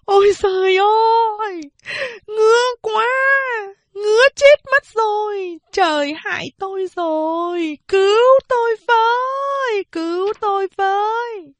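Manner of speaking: put-on desperate wailing old woman; wailing cry. A narrator's reading. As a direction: wail for help, itching to death. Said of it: "Bà Lã kêu khóc".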